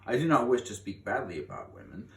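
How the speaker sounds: ordinary delivery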